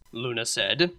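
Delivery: ordinary delivery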